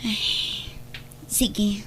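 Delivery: whispered agreement. Acted. Wife quietly agreeing at night.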